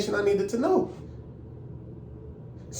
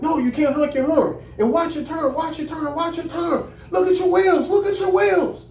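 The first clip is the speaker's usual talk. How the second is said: examiner yelling; 10 semitones higher than usual. Retold or imitated. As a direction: shout the orders at the trainee, getting higher each time. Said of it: "You're yelling at me".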